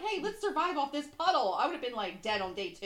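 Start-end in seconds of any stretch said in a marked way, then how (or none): 0.0-1.5 higher, louder mock cheer; chirpy send-up; mock hiker. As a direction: parody the couple's bright idea. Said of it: "then they were like".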